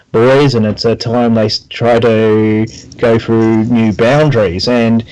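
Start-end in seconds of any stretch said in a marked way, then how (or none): none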